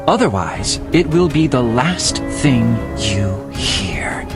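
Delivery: low, slow warning; menacing threat. Acted. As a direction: threaten them with slow, deliberate weight. Gunman threatens the pair.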